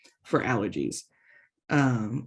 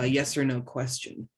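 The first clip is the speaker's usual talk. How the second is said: usual speaking voice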